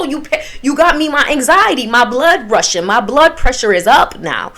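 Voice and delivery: ordinary delivery, nothing marked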